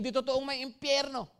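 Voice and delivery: lightly voiced mocking of a false teacher; mocking quote. Preacher quoting a Facebook pastor, a lightly mocking voice. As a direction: parrot the opposing pastor a little sneeringly. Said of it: "na sinasabi".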